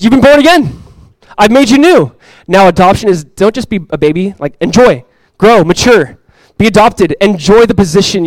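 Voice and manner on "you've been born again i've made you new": higher, louder exclamation; big, high-pitched announcement; emphatic declaration. Acted. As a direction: proclaim it with rising excitement. Acting God's declaration of new birth.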